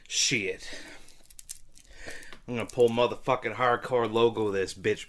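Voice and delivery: usual speaking voice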